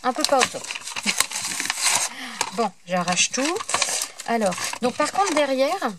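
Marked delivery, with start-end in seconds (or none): 0.7-2.5 petit rire gêné. Real, not acted. Rit de son anglais.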